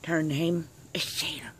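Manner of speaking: soft whispered introduction. Real, not acted. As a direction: whisper her name like a secret.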